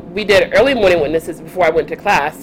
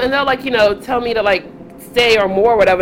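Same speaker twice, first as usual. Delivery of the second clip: normal talking voice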